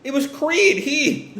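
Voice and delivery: high-pitched, stammering denial; acted frantic excuse-making; panicking. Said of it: "Jesse's still panicking".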